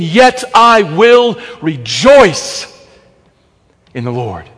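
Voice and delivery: slow solemn reading of the verse; solemn scripture reading. Acted. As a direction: proclaim it slowly like scripture.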